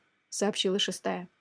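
ordinary delivery

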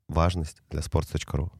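ordinary delivery

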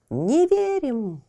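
slow high eerie chant; clamoring trees. Acted. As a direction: chant disbelief like rustling trees. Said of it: "расшумелись деревья".

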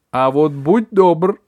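loud high drawn-out yell. A narrator's reading. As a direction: roar it at the boy. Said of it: "заорала мама".

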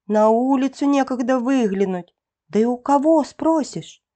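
plain reading voice

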